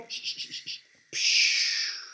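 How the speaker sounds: unvoiced hushing; whispered shush. Real, not acted. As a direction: hush the set in a whisper. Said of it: "shushing before take".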